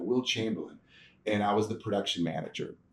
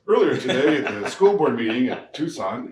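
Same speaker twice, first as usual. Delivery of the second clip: louder, anchorman-style stand-up read; mock news report; 3 semitones higher, 6 dB louder than usual. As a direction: deliver it like a local TV reporter on camera. Demonstrating his TV news stand-up.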